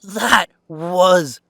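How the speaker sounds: loud, thrilled shout, the last word stretched slowly; cheering Talia for punching him, a loud delighted shout; excited cheer. Acted. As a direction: yell it out in delighted disbelief.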